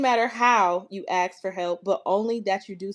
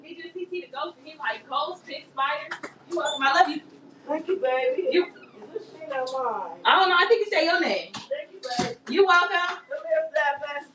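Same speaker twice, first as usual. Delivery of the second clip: lifted voice much higher than usual; high friendly chatter; 9 semitones higher than usual. Real, not acted. Reader chatting with neighbor's child at the door. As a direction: chat brightly at the door.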